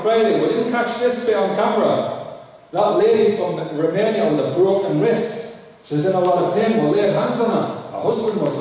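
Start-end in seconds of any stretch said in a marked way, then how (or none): none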